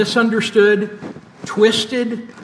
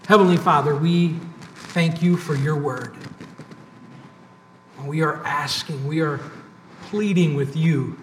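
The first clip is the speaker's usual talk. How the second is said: quiet, lower-pitched, pausing prayer; 4 semitones lower, 6 dB quieter than usual. Real, not acted. Preacher pleading with the Lord before the sermon, hushed prayer. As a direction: plead softly with God, leaving long pauses.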